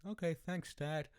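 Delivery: put-on high-pitched little boy voice; mock grateful kid. Son thanking dad for a sandwich, high childlike voice.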